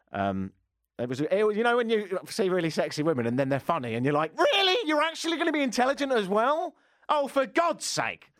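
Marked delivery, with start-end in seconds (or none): 4.4-8.3 high-pitched, incredulous outburst; mock exasperated disbelief. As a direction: squeal it in put-on outrage, slowing on the last line. Mock outburst at funny, sexy women.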